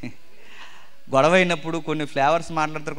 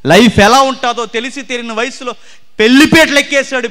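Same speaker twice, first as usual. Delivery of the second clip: louder, higher preaching burst; fervent preaching; 8 semitones higher, 9 dB louder than usual. Acted. Preacher to his congregation, fervent preaching. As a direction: drive it home to the congregation. Preacher rising to full force.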